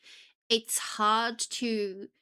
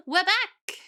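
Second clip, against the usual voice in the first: high, loud gasp and call; gasp, then sing out the return; excited announcement; 12 semitones higher, 5 dB louder than usual. Real, not acted. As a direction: burst back in excitedly.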